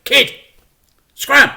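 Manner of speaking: high, loud gruff crook; shout. Acted. A crook shoos the child away with a loud high shout. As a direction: bark it at the kid.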